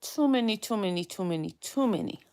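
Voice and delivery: soft muttered repetition, fading; muttering to herself. Real, not acted. Muttering disbelief repeatedly.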